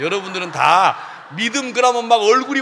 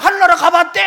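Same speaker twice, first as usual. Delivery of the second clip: mocking jab; 8 semitones higher, 6 dB louder, faster than usual. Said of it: "하고 막".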